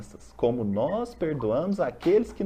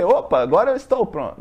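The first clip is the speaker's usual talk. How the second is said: louder, cocky self-assured boast; a hammed-up cocky athlete; mock confidence; 8 dB louder than usual. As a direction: brag like you skipped training but think you're ready. Mock overconfident player on game day.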